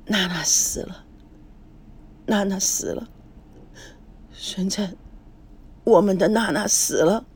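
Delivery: grieving mother murmuring to herself; dazed murmur. A narrator's reading. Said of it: "叶枫喃喃自语". (失神地喃喃自语)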